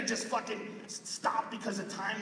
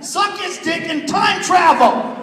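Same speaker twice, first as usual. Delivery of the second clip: big slow shout, held out; 8 semitones higher, 12 dB louder, slower than usual. Acted. Comic yells his advice to the crowd in a big slow shout.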